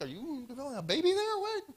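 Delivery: lightly voiced mock doctor teasing a patient. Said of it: "I'm like".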